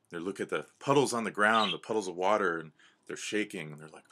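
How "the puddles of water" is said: plain reading voice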